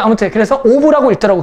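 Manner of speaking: ordinary talk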